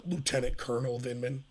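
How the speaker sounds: lightly voiced self-important officer; mock pompous correction. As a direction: drop the voice low and correct him primly. Mocking Vindman correcting a congressman.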